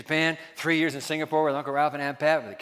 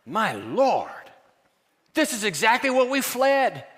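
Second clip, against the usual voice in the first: a lightly voiced Roger Williams, aghast and higher; Williams' dismay; 8 semitones higher, 6 dB louder than usual. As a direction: quote the shocked settler with raised pitch. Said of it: "and he says".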